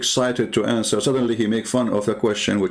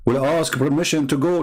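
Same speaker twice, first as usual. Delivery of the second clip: normal talking voice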